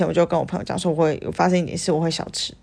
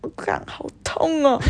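delayed pain groan; 8 semitones higher, 3 dB quieter than usual. Retold or imitated. Finally admits the pain alone.

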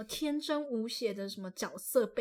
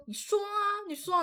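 put-on high, teary child daring him; hammed-up crying brat saying go ahead; child dares her uncle to tell, half-crying; mock tearful dare; 10 semitones higher than usual. Retold kid crying, daring uncle.

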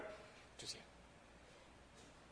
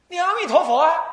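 raised voice; 10 semitones higher, 9 dB louder than usual. Retold or imitated. Master repeating to deaf man.

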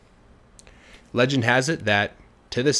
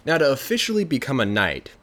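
plain reading voice

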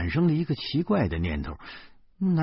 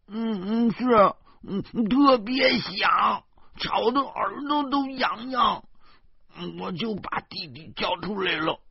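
小女孩的声音; little girl voice; 11 semitones higher than usual. Acted. Little girl answers her godfather.

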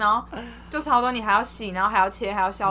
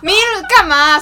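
louder, higher scolding yell; angry yell; 7 semitones higher, 10 dB louder than usual. Retold or imitated. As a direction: snap at the lost kid, full volume. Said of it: "然后凶到爆". (凶到爆地大声骂)

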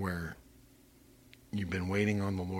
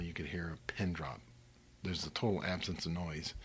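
hushed; 2 semitones lower, 8 dB quieter than usual. Acted. Describing utter stillness.

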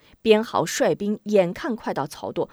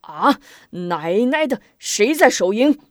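flustered curse; 2 semitones higher, 7 dB louder than usual. Acted. The general sees his camp ablaze, cursing in loud panic. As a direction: swear and bark who's on guard. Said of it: "慌了手脚".

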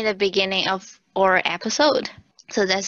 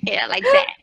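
laughing; 12 semitones higher, 4 dB louder than usual. Real, not acted. Approving the Kiwi take, laughing.

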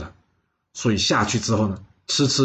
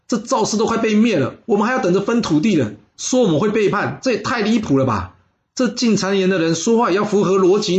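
indignant lord; 8 semitones higher than usual. Acted. Lord brushing off betrayal rumour, higher indignant protest. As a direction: scoff that the rumour is absurd.

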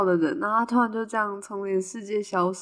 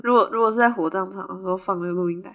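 usual speaking voice

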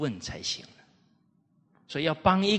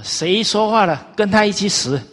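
raised, loud royal decree; angry emperor; 8 semitones higher, 9 dB louder than usual. Retold or imitated. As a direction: bark it like a furious emperor. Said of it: "他非常生气啊". (愤怒的皇帝下令)

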